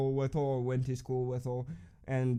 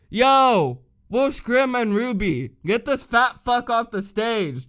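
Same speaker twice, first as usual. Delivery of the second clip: mock crowd heckle; 8 semitones higher, 8 dB louder, slower than usual. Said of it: "the crowd's kinda looking at him like".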